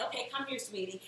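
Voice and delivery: put-on softly coaxing a small child; hushed, motherly coaxing; gentle coaxing. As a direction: call the little one over quietly. Retold mother coaxing her son.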